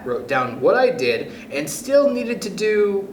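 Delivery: usual speaking voice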